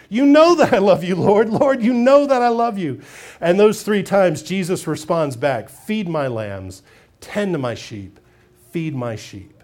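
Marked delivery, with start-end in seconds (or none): 0.1-3.0 louder, higher fervent declaration; earnest pleading. Acted. Peter pleading love. 5.7-9.5 low, slow, soft charge; gentle command. Acted. Jesus gentle commission.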